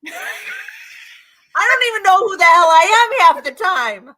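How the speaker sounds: high, loud, laughing outburst; laughing exclamation. Real, not acted. Confessing after playing many characters, high loud laughing shout. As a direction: cry it out slowly through laughter.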